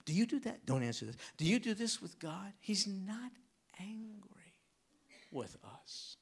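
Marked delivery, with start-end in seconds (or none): 2.6-6.2 whispered, soft and close; whispered reassurance. Real, not acted. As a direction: lean in and whisper the comfort. Reassuring the congregation.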